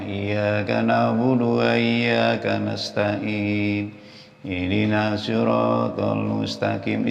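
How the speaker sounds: slow, slurred chant with "Iyyaka" left undoubled; careless recitation. Retold or imitated. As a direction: imitate someone reciting "Iyyaka" any old way. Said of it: "kayak semau-maunya".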